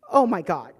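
loud burst of exasperation; outraged. Retold or imitated. Wife reacting to husband's dismissal, outraged. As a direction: explode in disbelief.

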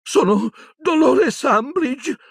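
high, scared official voice; shrill terrified Umbridge. Acted. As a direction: squeak it out in fear, holding her dignity. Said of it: "voce ... acuta, terrorizzata".

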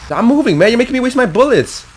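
high, loud complaint; rattled protest. Real, not acted. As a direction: shout in exasperation after a scare. Rattled after jump scare.

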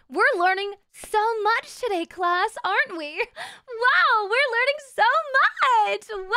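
high, loud, gushing fake-teacher voice; mock schoolteacher. Streamer playing teacher to her class, mock-amazed.

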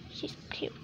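soft whispered aside; hushed admiring whisper. Real, not acted. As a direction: breathe it, barely voiced.

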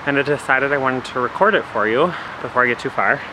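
breathless, panting between words; out of breath. Real, not acted. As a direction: talk while catching your breath. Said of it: "sorry I'm a little out of breath".

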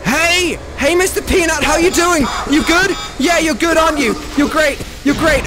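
butter up the monster to survive; fake sweet flattery. Sucking up to Mister Peanut monster.

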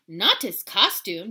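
loud, slow alarmed cry. Acted. Kitten refusing the costume, loud and alarmed. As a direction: blurt it out alarmed. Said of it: "declared Scapito, alarmed".

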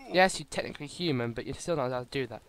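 ordinary delivery

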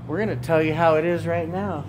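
ordinary delivery

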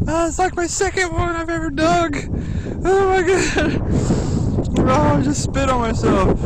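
high-pitched, loud delighted squeal; squealing with joy over a rare find; thrilled squeal. Real, not acted. Finder squeals with joy at the coin she just dug up.

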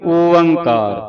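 ਓੰਕਾਰ long and loud, falling at the end; correct pronunciation. Shown as a demonstration. Teacher repeating the opening for children, loud and drawn out. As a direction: stretch both syllables of ਓੰਕਾਰ. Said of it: "ਇਸ ਤਰ੍ਹਾਂ ਉਚਾਰਨ ਕਰਨਾ".

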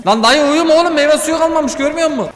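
high-pitched telling-off, rising urgency; exasperated scolding. Real, not acted. Player to the dozing worker in the game, exasperated scolding.

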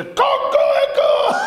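very high, loud drawn-out cock-a-doodle-doo; rooster crow. Acted. Rooster standing up in the yard, crowing loudly. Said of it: "התרנגול עומד".

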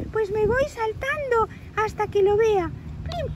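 put-on tiny, very high boyish squeak; little squirrel Ethan. Little squirrel asking to climb up and look, high childlike voice. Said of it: "dice Ethan, el chiquitín".

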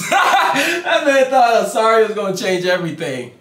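high, loud, laughing disbelief. Real, not acted. Reactor mocking the clown's apology, laughing loudly. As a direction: crack up while saying it.

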